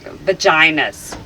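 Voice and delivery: loud, drawn-out outburst; involuntary blurt. Real, not acted. Hypnotized subject losing control of words, loud blurt. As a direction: blurt it out slowly and loudly, uncontrolled.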